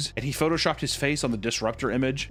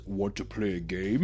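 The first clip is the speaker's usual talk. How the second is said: hushed mock-sinister invitation; low, quiet creepy hacker; 7 semitones lower, 5 dB quieter than usual. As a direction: lower and softer, a sly tease. Hacker son voiced.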